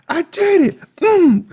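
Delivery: high, loud gleeful squeal; gleeful Emily. Retold or imitated. Mocking Emily celebrating her kill in a high squeal. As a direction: squeal it like a proud little sister.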